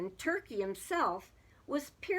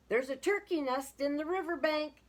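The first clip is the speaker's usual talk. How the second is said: mild, higher-pitched call; raised-pitch storybook call; 6 semitones higher, 4 dB louder than usual. A narrator's reading. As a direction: lightly call the news across. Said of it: "Sheep called".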